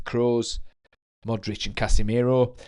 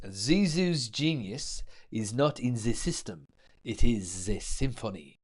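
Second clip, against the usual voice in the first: put-on French accent; 5 dB quieter than usual. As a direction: put on a mock French accent, savouring each word. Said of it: "the [name]'s brilliant French accent".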